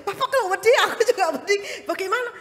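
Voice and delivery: high, loud, fast laughing protest; mock panic, laughing. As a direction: laugh at the scared driver.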